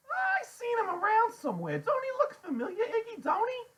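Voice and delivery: very high, loud woman character; Loretta's voice. A narrator's reading. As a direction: pester him in a high, loud voice.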